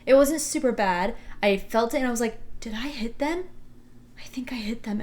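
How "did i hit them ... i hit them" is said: hushed, breathy self-question, trailing to a whisper; panicked whisper. Retold or imitated. Teen driver whispering to herself after bumping a car, panicked whisper. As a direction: whisper it to yourself in shock.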